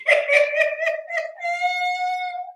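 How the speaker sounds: long high cackle; high laugh. Real, not acted. Laughing at Jada sounding like a bae, long cackle.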